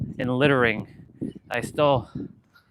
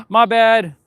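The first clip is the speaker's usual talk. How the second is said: gasping, louder and higher; jolted, blurt it out; startled apology; 8 semitones higher, 7 dB louder than usual. Real, not acted. Vlogger startled by a bug, gasping apology.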